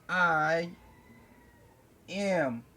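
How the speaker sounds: ordinary talk, nothing marked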